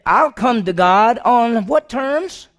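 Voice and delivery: slow, loud scornful challenge; defiant Cain. Acted. Cain defying God about his sacrifice, slow scornful challenge. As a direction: dare God to set the terms. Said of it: "He said".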